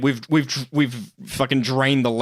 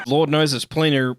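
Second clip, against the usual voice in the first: normal talking voice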